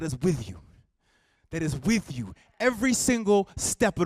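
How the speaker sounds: impassioned speech with punched key words; rousing oratory. Acted. Speaker urging a crowd to stand firm, rousing oratory. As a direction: build the conviction in measured, emphatic phrases.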